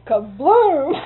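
loud mock kablooey; explosion sound. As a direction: burst out an explosion noise. Retold explosion of a switch box.

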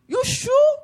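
put-on high, hurried child's voice; small boy's voice. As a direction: rattle off the list like a boy. Boy asks old lady.

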